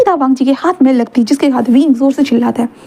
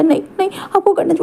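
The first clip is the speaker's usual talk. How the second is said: urgent plea; 7 semitones higher, 4 dB quieter, faster than usual. Acted. Wing pleads with Wang Ji.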